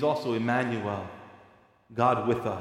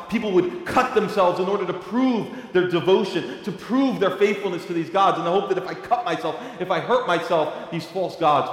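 high, loud, impassioned pulpit cry; preaching at full force; 7 semitones higher, 7 dB louder than usual. Acted. Preacher urging his congregation, preaching at full force. Preaching about pagan gods.

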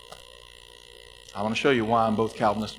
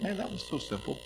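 wondering; 9 dB quieter than usual. Retold or imitated. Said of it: "we're gonna think".

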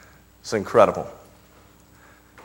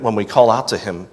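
usual speaking voice

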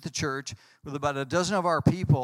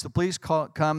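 normal talking voice

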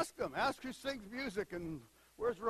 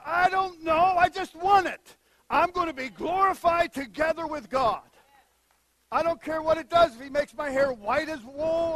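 much louder and higher, ecstatic; fervent shout; 5 semitones higher, 11 dB louder than usual. Real, not acted. Preacher longing to be glorified with God, fervent shout. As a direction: cry out with holy longing.

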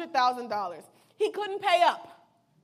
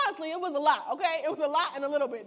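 normal talking voice

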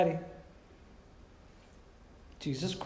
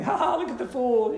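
higher, jeering "ha, ha" at a fool; mocking laugh; 8 semitones higher, 5 dB louder than usual. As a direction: laugh him down like the mourners would. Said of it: "It's a mocking laugh".

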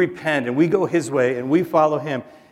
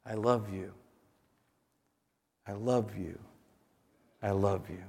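very soft, low and hushed, spaced with long pauses; low, tender murmur of reassurance; tender voice of God; 5 semitones lower, 12 dB quieter than usual. Acted. God speaking over all our lives, tender and hushed. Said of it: "the voice of God speaks over all".

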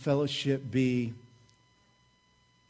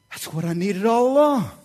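higher, louder, swelling delight; won-over listener; 4 semitones higher, 6 dB louder than usual. Acted. People hearing praise they understand, delighted and swelling. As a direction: let it burst out like a happy discovery. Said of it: "people are going".

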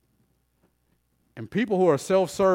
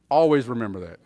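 ordinary delivery

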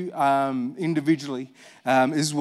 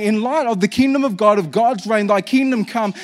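ordinary talk, nothing marked

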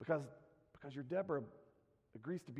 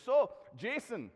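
loud, high-pitched mock Scottish; Scottish accent; 9 semitones higher, 7 dB louder than usual. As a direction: imitate a Scotsman putting a blunt question. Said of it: "he said it just like that".

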